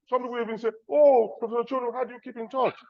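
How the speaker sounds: usual speaking voice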